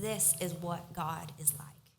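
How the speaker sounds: slow, reverent whisper; hushed whisper. Acted. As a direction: let it land in a hush. Quoting Jesus, hushed emphasis.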